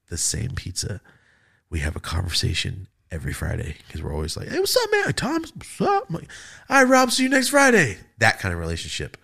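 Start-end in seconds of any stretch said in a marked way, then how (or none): 4.5-6.1 friendly bro greeting. Retold or imitated. Friendly greeting at pizza delivery. 6.7-8.0 cheery goodbye. Retold or imitated. Cheery goodbye to regular customer.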